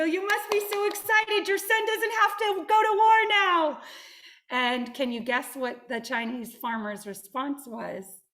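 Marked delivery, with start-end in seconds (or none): 0.0-3.8 very high, loud, thrilled cry; overjoyed workers. Acted. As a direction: gush it like elated farmhands. Workers rejoice over draft.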